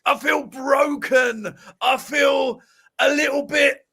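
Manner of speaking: high, loud, slow, anguished mock lament; a fan a few whiskeys in, wailing; hammed-up broken-hearted pundit after the game; Terry Flewers impression. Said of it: "I'm trying to work on his accent as well".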